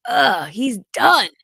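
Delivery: exasperated ugh. Retold or imitated. Son giving up.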